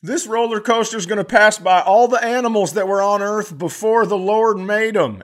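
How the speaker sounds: high, loud tour voice; hammed-up ride announcer. Ride voice introduces the animal exhibit, high and loud. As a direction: announce it like a theme park ride.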